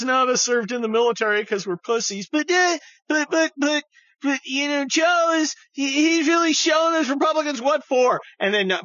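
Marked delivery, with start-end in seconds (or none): 2.3-8.2 high stammering mock lib; hammed-up squeaky liberal. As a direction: sputter it like a gushing fan. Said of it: "all these libs are like".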